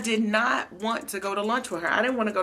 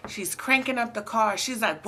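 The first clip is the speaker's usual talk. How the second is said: ordinary talk, nothing marked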